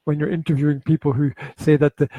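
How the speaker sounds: ordinary delivery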